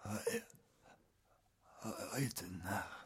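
barely voiced, broken whisper; dying gasp. Dying man revealing when the meeting is, faint broken whisper. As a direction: force out the words with a last breath. Dying man's last words.

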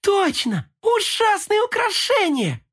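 high delighted squeal; excited. A narrator's reading. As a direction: burst with glee. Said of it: "очень обрадовалась".

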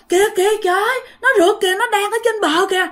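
high, urgent cries of alarm; panicked cry. Acted. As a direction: point out the pursuer in terror, high-pitched. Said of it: "Tự nhiên bà này la vậy".

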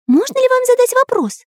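very high, polite cartoon hero voice; eager artist character. Artist asks spirit about the treasure, high eager voice. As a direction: question a spirit respectfully, pitched high.

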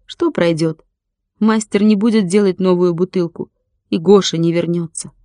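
ordinary delivery

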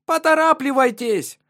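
high, loud urgent shout. Acted. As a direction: yell for them to hurry. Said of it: "крикнула её мать".

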